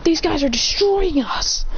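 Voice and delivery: high-pitched, acted alarm; panicked call for backup. Final enemy calls for help.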